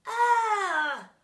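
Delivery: high, loud cry of disgust. A narrator's reading. Class of kids crying out in disgust. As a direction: yelp like grossed-out kids.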